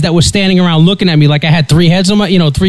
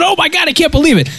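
much higher and fast, playing it up; mock ecstatic; 11 semitones higher, faster than usual. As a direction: gush like you just won the lottery. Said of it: "And she made me excited".

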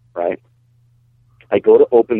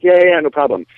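higher-pitched, imitated "no worries" brush-off; breezy reassurance; 7 semitones higher, 4 dB louder than usual. Helper brushing off Dave's careful instructions, breezy retold reassurance. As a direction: reassure him lightly and quickly, retold.

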